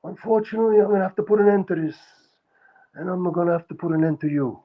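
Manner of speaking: high, menacing Sicilian mobster threat; a Sicilian accent threat, pitched high. Shown as a demonstration. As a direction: play a Sicilian mob enforcer, cold and sure. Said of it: "I'll show you".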